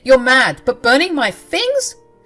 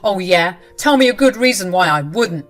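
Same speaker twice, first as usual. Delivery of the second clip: defiant challenge; 9 dB louder than usual. Acted. Husband challenges wife.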